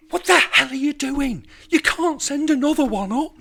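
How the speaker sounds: high, loud exasperated shout; hammed-up outrage at Adam; mock furious yell. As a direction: tear into Adam for it. Owner scolding Adam with live pig.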